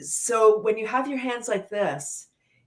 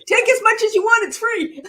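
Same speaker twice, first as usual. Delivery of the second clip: much higher, louder, laughing; laughing pitch; 8 dB louder than usual. Pretend. Leader offering pretend cream, laughing high. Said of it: "take out some laughter cream".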